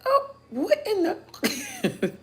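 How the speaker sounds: very high, slow, breathy gasp of disbelief; stunned, drawn out; shocked. Retold or imitated. Reliving her shock at the cashier, a high slow gasp. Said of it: "I was shocked".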